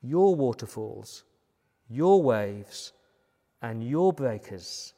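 "your" hit hard each time, with pauses between phrases; deliberate, spaced phrases leaning on "your"; stress on YOUR. Shown as a demonstration. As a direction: punch "your", then let each phrase fall. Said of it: "But spot that word "your".

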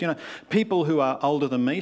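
usual speaking voice

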